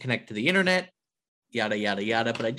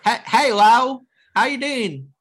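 excited greeting; 11 semitones higher, 9 dB louder than usual. Acted. Hammed greeting of the topic.

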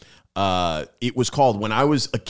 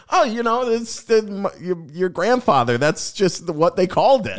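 higher-pitched, hesitant mom voice; mom explaining away; 6 semitones higher than usual. Retold or imitated. Mom explains the family's name for a hot dog stand, hesitant mock explaining. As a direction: imitate a mom searching for an excuse. Mom's casual explanation retold.

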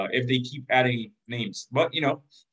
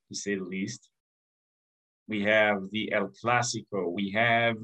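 ordinary talk, nothing marked

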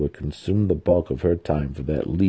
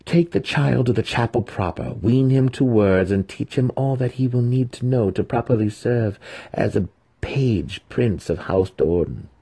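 higher, firm list of orders; commanding matron; 6 semitones higher than usual. A narrator's reading. Matron instructs her daughter on raising the boy, commanding. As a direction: spell out the duties.

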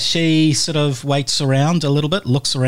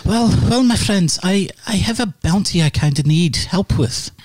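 lady's voice; 8 semitones higher than usual. Acted. GM's lady asks the party for help, high and croaky. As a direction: coax the group, high and croaky.

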